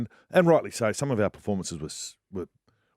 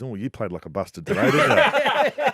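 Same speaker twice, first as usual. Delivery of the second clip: mum's teasing jab; 2 semitones higher than usual. Retold or imitated. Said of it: "my mum and goes".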